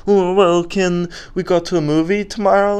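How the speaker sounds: high-pitched hopeful pleading; mock panicking. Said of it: "you start panicking".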